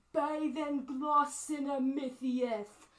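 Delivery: loud, high, slow proclamation; declaimed challenge. Acted. Highlander points at the orc leader, declaimed challenge. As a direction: pronounce it slowly like a doom on the foe.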